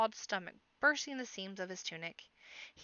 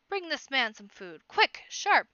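loud barked commands, higher on Sharp; shouted orders; 4 semitones higher, 9 dB louder, slower than usual. A narrator's reading. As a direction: snap the orders at the servants. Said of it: "Hubert shouts".

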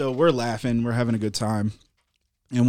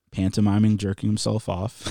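usual speaking voice

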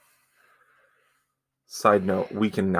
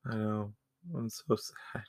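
mock sad whisper; 12 dB quieter than usual. Real, not acted. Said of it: "I'm losing my mind".

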